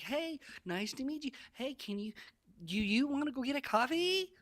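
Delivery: squeaky eager ask, voice shooting up; high simpy voice. Shown as a demonstration. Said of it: "You notice my voice inflection?".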